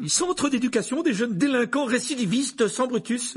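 very high, loud exclamation; panicked outcry. A narrator's reading. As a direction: cry out the correction in alarm. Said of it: "s'écria l'oncle Vernon".

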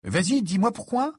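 high, pushy demand; insisting, voice jumping up; insistent. A narrator's reading. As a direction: press hard for an answer. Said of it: "insista Harry".

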